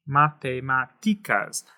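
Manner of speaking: "Matemáticas" slow, with the stress on MA-TI, ma-te-MÁ-ti-cas; slow model with the stress on the syllable before the T. Shown as a demonstration. Teacher slowly modeling the stress of "Matemáticas". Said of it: "It should fall right here on the T".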